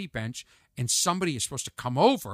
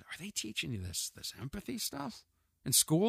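hushed question; 3 semitones higher, 9 dB quieter than usual. Retold or imitated. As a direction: ask it quietly. Asking nephew about school empathy lessons.

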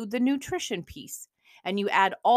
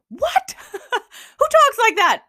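high, loud disbelieving laugh; shocked, laughing what; incredulous laugh; 11 dB louder, slower than usual. Real, not acted. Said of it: "I'm like".

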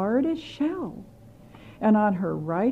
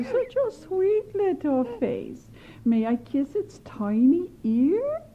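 high, slow wheedling granny; coaxing; 7 semitones higher, slower than usual. Acted. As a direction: coax the mother like a sweet old witch. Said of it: "trying to coax her".

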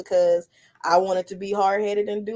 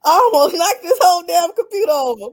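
laughing; 9 semitones higher, 6 dB louder than usual. Real, not acted. Laughing after near accident.

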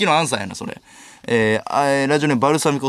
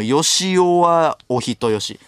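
very slow read; much slower than usual. Acted. Reading a name slowly.

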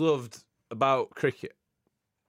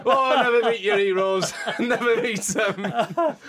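chuckling through the words, slow at the end; laughing punchline; 7 semitones higher, slower than usual. Real, not acted. Telling the Botham story's moral, laughing. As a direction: laugh it out, rueful and amused.

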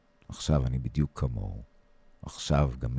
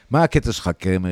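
angry outburst; 4 semitones higher, 6 dB louder than usual. Acted. Sergeant snaps back at soldier.

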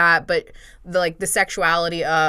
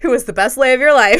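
higher, louder joke through real laughter; laughing quip; 6 semitones higher, 8 dB louder, faster than usual. Real, not acted. Host jokes to cohost about Sappho's lover, laughing loudly. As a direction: toss the cheeky line out while laughing.

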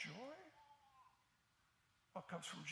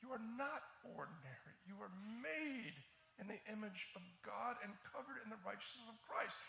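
ordinary talk, nothing marked

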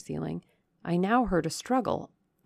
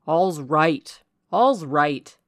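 cried reassurance; 9 dB louder, much slower than usual. A narrator's reading. Rochester beset by clinging ladies, loud reassurance. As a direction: project it over the panic to settle everyone. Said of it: "he cried".

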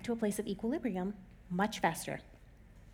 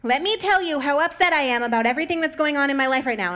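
higher, louder mock complaining; whiny coworker; 5 semitones higher, 9 dB louder than usual. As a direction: whine it like a coworker venting. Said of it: "everyone kept calling me and being like".

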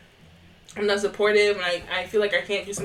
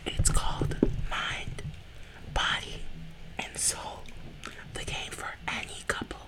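ASMR whisper; 10 dB quieter than usual. Pretend. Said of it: "Emphasis on the any".

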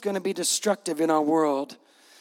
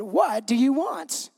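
slow, higher, stretched-out question; mock exasperated; 4 semitones higher, slower than usual. Preacher shows how he would answer the mother, mock exasperated. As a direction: drag it out, hammed-up impatience. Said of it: "I would have said".

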